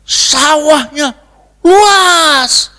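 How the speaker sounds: high, loud, stretched-out words; exclaiming. Real, not acted. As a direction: stretch the words out in a big shout. Preacher exclaims over huge field. (seru lantang, nada tinggi dipanjangkan)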